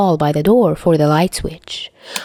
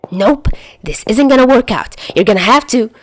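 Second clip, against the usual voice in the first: hasty protest; 5 semitones higher, 3 dB louder than usual. Acted. Chuya bolting from the room.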